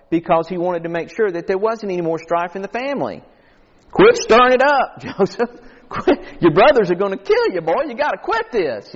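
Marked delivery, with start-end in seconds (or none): 3.9-8.9 acted scolding, higher pitched; imitated rebuke. Father warning his son Joseph about his brothers, imitated rebuke. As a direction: play an exasperated father scolding. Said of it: "But he did rebuke him".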